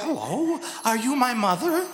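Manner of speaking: a newborn asking its maker; innocent snowman. Acted.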